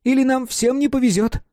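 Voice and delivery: put-on child voice. A narrator's reading. Child Mark warns at party.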